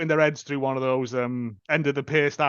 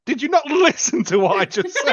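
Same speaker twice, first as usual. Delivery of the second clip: mock exasperated; 11 semitones higher, 4 dB louder than usual. Retelling a film character's snippy reply.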